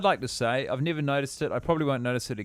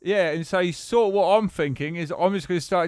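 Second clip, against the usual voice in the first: light put-on English accent, a bit higher; playing a bloke from England, lightly done; 4 semitones higher than usual. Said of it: "I sort of talk like this".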